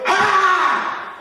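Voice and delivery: long high battle cry; attack yell. Acted. Shoemaker striking at the condemned man, attack yell. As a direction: yell as you swing the sword.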